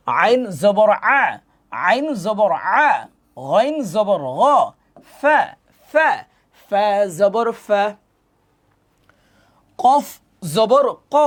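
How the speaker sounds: slow, drawn-out spelling chant; recitation drill. Shown as a demonstration. Teacher leading learners through letters, chanted drill. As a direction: stretch each letter name in a rising-falling lilt.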